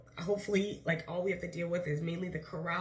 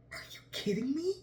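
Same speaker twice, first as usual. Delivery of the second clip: stunned whisper, pitched up; whispered disbelief; 7 semitones higher than usual. Retold or imitated. Reacting to the organizer asking for another day, stunned whisper. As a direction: breathe it out in shock. Reaction to a third show request.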